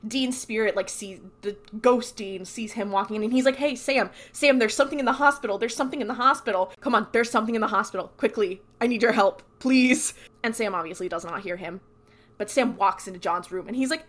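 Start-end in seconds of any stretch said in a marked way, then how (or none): 3.6-10.1 rapid, raised-pitch retold plea; urgent pleading. Ghost begs his brother who doesn't hear him, urgent plea. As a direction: rattle out an urgent warning, then punch the please.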